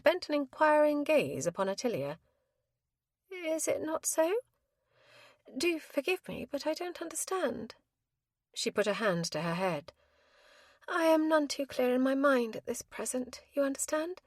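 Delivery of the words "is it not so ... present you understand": bewildered widow. A narrator's reading. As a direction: sound lost and muddled. Confused widow, hand to head.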